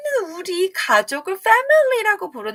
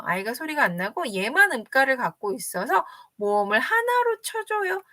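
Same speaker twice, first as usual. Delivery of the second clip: ordinary talk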